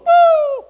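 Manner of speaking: high, loud "uh!"; a sudden high hoot as if knocked over; mimicked falling whoop. Man acting out collapsing at pastor's touch, high whoop. Acts out being struck down.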